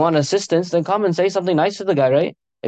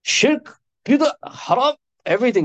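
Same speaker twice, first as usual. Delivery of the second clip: high-pitched mock outrage, not shouted; mad scolding; 6 semitones higher than usual. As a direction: rap out each accusation, pitched well up. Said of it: "got so mad at the guy".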